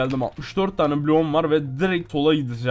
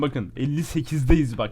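usual speaking voice

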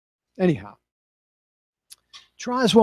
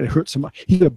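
usual speaking voice

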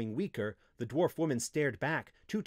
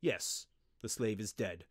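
matter-of-fact, cold delivery; 3 semitones lower than usual. A narrator's reading. Wizard dismissing the slave's death, coldly. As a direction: state it without feeling. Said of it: "the wizard said coldly".

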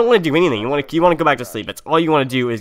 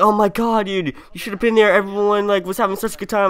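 higher, gushing imitation of a hyped friend; 6 semitones higher than usual. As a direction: rave about the party. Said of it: "he was, like, hyped".

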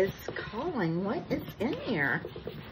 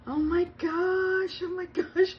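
gasp of delight; 9 semitones higher than usual. Real, not acted. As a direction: gasp and gush over the gift. Unpacking the gift.

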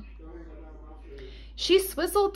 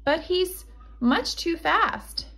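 Little Red, slowly; 7 semitones higher than usual. Acted. Little Red objects to Grandma.

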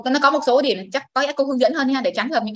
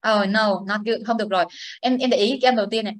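usual speaking voice

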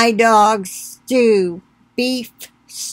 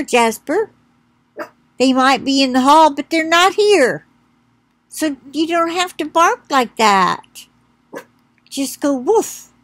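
talking to the dog; 5 semitones higher than usual. Real, not acted. Soothing the dog Jasper.

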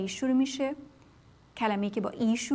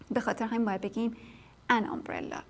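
usual speaking voice

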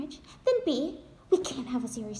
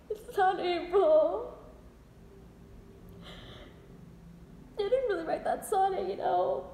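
high, choked voice; tearful admission; 5 semitones higher than usual. Acted. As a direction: confess it while holding back tears. Tearful confession to husband.

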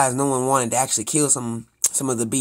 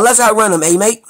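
excited taxi driver; 8 semitones higher, 8 dB louder than usual. Acted. Voices the Australian driver's line.

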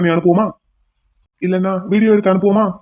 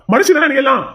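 angry yell; 6 semitones higher than usual. Acted. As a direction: spit it at her in disgust. Repeats question louder. (உயர்ந்த குரலில் வெறுப்புடன் கத்தல்)

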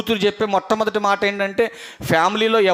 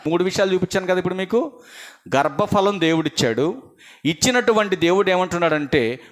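normal talking voice

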